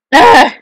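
loud, high short scream; sudden yelp at a hit; startled scream. Real, not acted. Player hit by spikes, startled scream.

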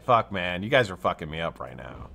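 usual speaking voice

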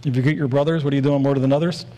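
brisk rhyming repeat; quick rhyme. Shown as a demonstration. Preacher pointing out a rhyme in the verse, quick recital. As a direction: run it off quickly to show the rhyme. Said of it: "There's like a rhyme there".

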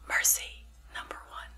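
faint, distant whisper; a ghostly voice from within; different voice. Retold or imitated. An inner voice answering her prayer, in a faint whisper. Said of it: "Different voice".

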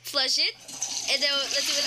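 usual speaking voice

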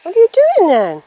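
high, bright call to the cat; pet talk. Real, not acted. Coaxing a cat to come over, high pet voice.

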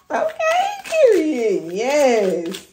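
very high, loud, laughing; laughing hype. Real, not acted. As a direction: squeal it.

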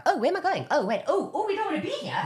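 high-pitched flustered self-talk; mock alarm. Actor imitating their body pulling away, mock alarm. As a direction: play up being startled by your own feet.

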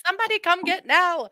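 high-pitched mock holler; retold yell. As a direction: re-enact calling out to the household. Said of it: "I yelled".

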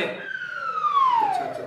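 high, very slow sarcastic "अच्छा"; mocking drawn out. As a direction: stretch it out, teasing. (चिढ़ाते हुए खींचकर)

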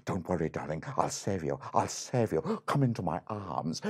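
low, soft heroic prince voice; calm the princess gallantly; hammed-up storybook hero; reassuring prince.

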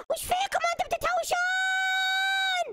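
put-on a high, slow scolding shout at two squabbling girls. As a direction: break up the fight with a drawn-out high yell. Breaking up the dolls' fight. (صيحة توبيخ عالية وبطيئة)

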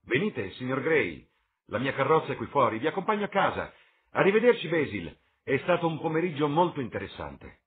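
high, loud voice of a sociable lord; breezy lord taking leave. Acted. Lord Henry leaves with Gray, high and breezy.

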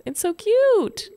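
very high, drawn-out coo; gushing delight. Real, not acted. Player sees the new house, high gushing delight. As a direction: squeal it slowly with delight.

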